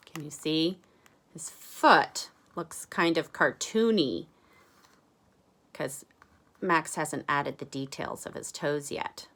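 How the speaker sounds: plain reading voice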